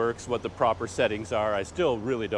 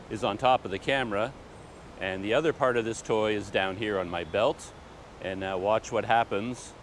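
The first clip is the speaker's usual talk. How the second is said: ordinary talk, nothing marked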